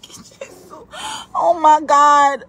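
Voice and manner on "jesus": breathless whisper of Jesus. Real, not acted. Exasperated streamer whispering to herself, breathless whisper.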